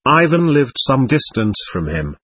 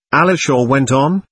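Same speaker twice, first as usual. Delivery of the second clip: plain reading voice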